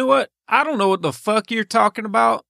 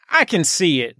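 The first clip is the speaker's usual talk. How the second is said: usual speaking voice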